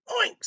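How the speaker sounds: short high-pitched oink; pig oink. A narrator's reading. Piggy greets Blue, high oink. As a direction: squeal a quick little oink. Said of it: "said a piggy".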